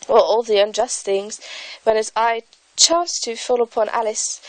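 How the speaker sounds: put-on a lightly done English accent, reading aloud; light British accent. YouTuber reads Alice aloud in a light British accent. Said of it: "I'm going to read a part from".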